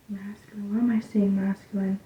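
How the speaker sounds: hushed, half-whispered puzzled aside; quiet wondering to yourself; muttering to self. Real, not acted.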